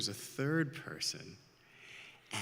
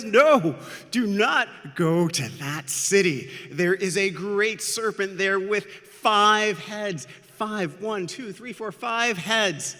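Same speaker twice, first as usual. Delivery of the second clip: loud, high, frantic storybook cry; panicked warning; 8 semitones higher, 7 dB louder than usual. A narrator's reading. Sister runs up warning of a great serpent, panicked. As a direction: warn urgently at raised pitch and volume.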